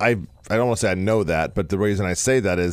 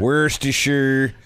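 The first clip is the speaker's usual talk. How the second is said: drawn-out, higher silly "Worcestershire"; 4 semitones higher, much slower than usual. Shown as a demonstration. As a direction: mispronounce it slowly in a goofy voice. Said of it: "do it a silly voice".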